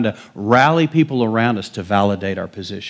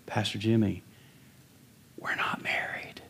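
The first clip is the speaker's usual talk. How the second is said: whispered, much softer; a hushed secret told to the pastor; hushed confession; 2 semitones lower, 13 dB quieter than usual. Retold or imitated. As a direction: couple whispering to the pastor that they are not married. Couple confessing quietly to pastor.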